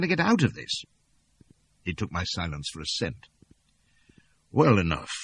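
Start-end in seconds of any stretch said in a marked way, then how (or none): none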